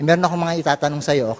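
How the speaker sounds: normal talking voice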